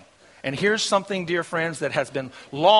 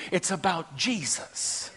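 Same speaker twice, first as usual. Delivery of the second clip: usual speaking voice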